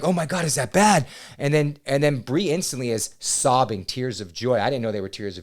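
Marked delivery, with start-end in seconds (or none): 0.0-1.1 mock panic, higher and louder; comic bewildered outburst; alarmed question. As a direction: replay the clueless worry. Acting out his bewildered alarm.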